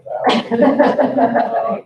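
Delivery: slow, high, drawn-out disbelief at the number; mock sticker shock. Board member balking at the price quote, slow and high in mock disbelief. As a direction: drag the figure out like it's outrageous.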